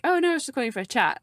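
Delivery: high, loud, fast imitation; quick, chirpy imitated reply; breezy reassurance. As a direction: rattle it off as a casual caller would. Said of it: "rather than, like".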